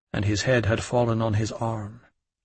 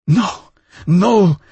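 high, much louder frantic cry; 7 semitones higher, 9 dB louder than usual. A narrator's reading. Woman refuses Louis, frantic shout. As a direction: cry no in panic. Woman shakes head violently.

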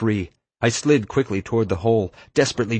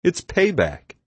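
proud, higher-pitched declaration; 9 semitones higher, slower than usual. A narrator's reading. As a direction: announce it with satisfied pride. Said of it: "Chip said proudly".

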